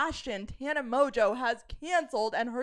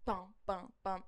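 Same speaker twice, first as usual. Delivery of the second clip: low, soft mouthed bass beat; quiet low vocal kick drum; imitated house beat; 5 semitones lower, 11 dB quieter than usual. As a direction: thump out a gentle house beat. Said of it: "This was more like".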